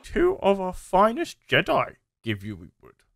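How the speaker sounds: high, strained little Yoda voice. Acted. Yoda offering two Jedi to the stranger, high Yoda voice. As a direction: croak it out like the tiny green master. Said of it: "Yoda says".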